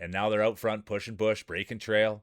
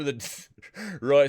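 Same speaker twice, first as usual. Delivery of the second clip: breaking into laughter mid-name; can't hold back a laugh; 5 semitones higher, 5 dB louder than usual. Real, not acted. Commentator laughing at a crashed racer, real laughter. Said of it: "sorry, I'm laughing".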